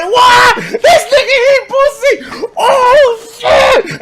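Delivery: high, loud laughing shock, slow; laughing disbelief. Retold or imitated. Crowd reacting at lunch, high laughing shock. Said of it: "Everybody was like".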